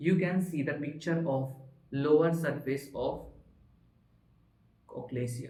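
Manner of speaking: slow, careful reading aloud; textbook reading. Shown as a demonstration. Teacher reading the textbook slowly to his class. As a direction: read the textbook line by line to students.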